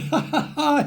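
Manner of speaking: acted high ha-ha-hee laugh; mock donkey laugh. Storyteller imitating the laughing donkey, mock laugh. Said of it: "está riendo".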